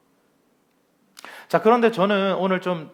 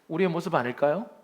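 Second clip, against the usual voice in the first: usual speaking voice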